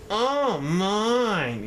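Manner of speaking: high, thrilled exclamation; delighted cry. Acted. Yertle on his new turtle throne, high and thrilled. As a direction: gasp with delight at the view. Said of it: "Yertle cried".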